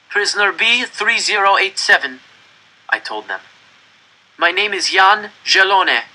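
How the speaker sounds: put-on boy lying his name to an officer; nervous boy. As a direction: say it brave but scared. Boy giving false name to SS.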